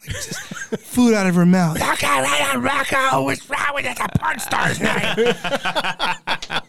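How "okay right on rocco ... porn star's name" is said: higher, mocking voice of the outraged mother; mock outraged mom. Son imitating mom flipping out over the name, mock outrage. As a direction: play the shocked mom, hammed up.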